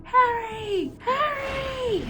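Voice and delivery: very high, far-off calling; distant cries. A narrator's reading. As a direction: call his name from a distance.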